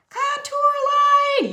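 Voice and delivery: high, very loud, stretched quiz answer; triumphant call-out. Pretend. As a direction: shout it out like the winning answer. Calls out answer to own question.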